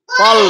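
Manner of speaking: firm correct তল for the class; teacher's demo. Shown as a demonstration. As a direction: punch out তল with an open mouth. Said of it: "মুখটা বড় হবে কিন্তু গোল হবে না".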